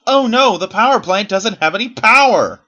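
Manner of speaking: high, loud mock-alarmed cry; mock panic. Player mocking a power plant without power, in mock panic. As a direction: cry out in exaggerated dismay.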